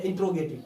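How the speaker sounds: "इंट्रोगेटिव" with "tro" run together, no vowel after the t; common mispronunciation. Shown as a demonstration. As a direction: squeeze "इंट्रोगेटिव" into in-tro-ge-tiv. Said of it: "बहुत सारे लोग इंटेरोगेटिव कहते हैं". ("इंट्रोगेटिव" का गलत उच्चारण, ट और र मिलाकर)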